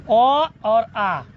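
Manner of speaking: ओ और आ held very long from the throat; drawn-out vowel demo. Shown as a demonstration. Teacher to pupils, slowly drawing out throat vowels. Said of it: "यह जो है कंठ से उच्चारण होगा".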